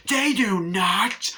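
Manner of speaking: high, drawn-out indignant shout; mock rage. Imitating Annie scolding Paul over his profanity, outraged shout. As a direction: shriek it slowly in imitation of a fit of rage. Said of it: "the rage scene is scary".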